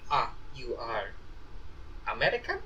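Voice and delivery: put-on Russian-accented broken English; halting Russian accent, rising question. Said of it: "in very broken English".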